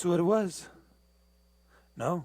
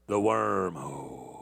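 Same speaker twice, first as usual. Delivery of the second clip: low ominous; 8 semitones lower, slower than usual. Real, not acted. Mock ominous about the YouTube wormhole, low and slow. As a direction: lower and slower, drawn out.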